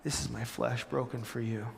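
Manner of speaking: soft, tender retelling of Jesus' words; gently, as if handing it to friends; confident and loving. Retold or imitated. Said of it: "saying it confidently and lovingly".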